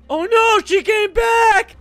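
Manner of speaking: high, loud, slow drawn-out wail; loud high panic; panicked shout. Real, not acted. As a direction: yell in real alarm as she returns. Cop reappears during escape.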